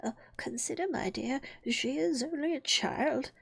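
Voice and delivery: soft, hesitant, half-whispered plea; timid. A narrator's reading. King pleading with the Queen for Alice, timidly. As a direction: plead gently, afraid to provoke. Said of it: "timidly said".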